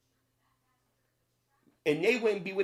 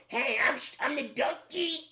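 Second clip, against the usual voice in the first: higher, goofy cartoon donkey voice; silly cartoon voice; 9 semitones higher than usual. Shown as a demonstration. Vlogger mocking the donkey voice, high goofy cartoon voice. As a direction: play it up like a cartoon sidekick. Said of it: "I could sit right here and come up".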